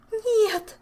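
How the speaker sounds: high, loud cry of no; desperate scream. A narrator's reading. Accused favourite screaming at the king. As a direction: scream it in despair. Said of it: "закричала несчастная фаворитка".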